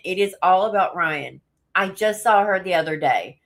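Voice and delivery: normal talking voice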